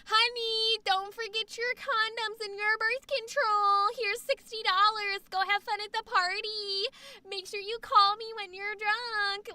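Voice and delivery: put-on high, chirpy young-mom voice; singsong and high, doting party-friendly mom; young cool mom. Young mom sending daughter off to a party, breezy doting voice. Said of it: "And she's like".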